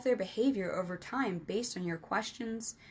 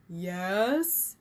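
louder, long stretched word rising to a question; drawn-out yes; 4 dB louder than usual. A narrator's reading. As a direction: stretch it out, waiting for the catch. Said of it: "Lawrence drew out the word".

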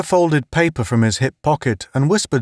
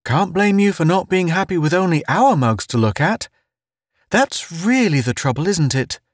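high, needling character voice; arch; 8 semitones higher than usual. A narrator's reading. Town probing Pace's discontent, arch. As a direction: needle him archly, pitched high. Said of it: "Town said archly".

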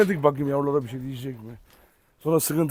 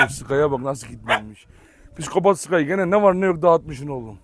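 ordinary talk, nothing marked

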